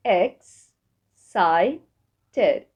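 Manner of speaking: "X، ساي، تد" as three separate beats; syllable by syllable. Shown as a demonstration. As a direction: break it into "X", "ساي", "تد" with pauses. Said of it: "أقسمها لمقاطع". (نطق "X، ساي، تد" مقطعًا مقطعًا)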